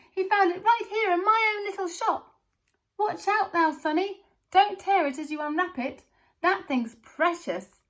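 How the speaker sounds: high, loud thrilled yell. A narrator's reading. Shopkeeper yells to the crowd, thrilled and loud. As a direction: yell it out in disbelief.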